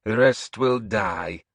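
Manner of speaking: put-on a menacing old witch pronouncing doom; old seeress Breda. Old seeress to the hall, slow and eerie.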